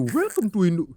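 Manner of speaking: mock Indian accent; quick, hammy Indian-accented greeting; overdone Indian accent. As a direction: imitate dad's exaggerated Indian English. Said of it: "lebay banget".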